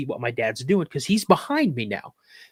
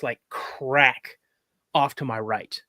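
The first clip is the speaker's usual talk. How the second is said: usual speaking voice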